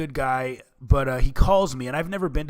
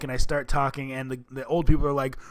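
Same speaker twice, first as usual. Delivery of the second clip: normal talking voice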